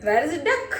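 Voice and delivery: ordinary delivery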